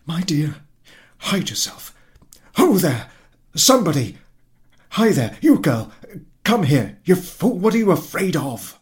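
high, loud shouting for the maid; husband yelling for a servant girl; calling servants. Acted. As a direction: summon the servants in a rush.

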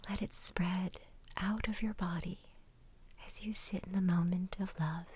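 hushed ASMR meditation voice; whispered meditation. Acted. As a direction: whisper them gently into calm.

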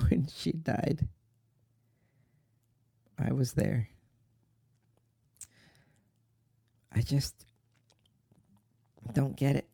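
soft, halting, near a whisper; choked grief. Acted. Son reliving mother's death for brother, choked up. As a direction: barely get the words out through tears.

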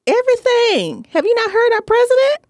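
high, wide-eyed conspiracy theorist; hammed-up paranoid whisper-turned-squeal. Mock conspiracy theorist bit.

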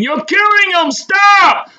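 piercingly high, loud scream; crowd screaming. A narrator's reading. Onlookers to police choking a man, screaming. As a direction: scream for it to stop. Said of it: "crowd was still screaming".

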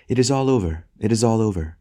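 lightly voiced wife crying out in relief. A narrator's reading. Wife reassuring her husband, crying out. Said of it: "she cried".

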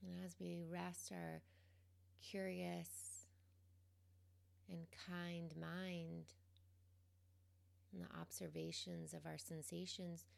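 calm, quiet guidance, leaving space between phrases; guided meditation. Real, not acted. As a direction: gently guide them into the present moment, unhurried.

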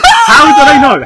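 high, loud laughing outrage; raging gamer. Real, not acted. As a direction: scream it at full volume in disbelief. Outraged at the quiz answer.

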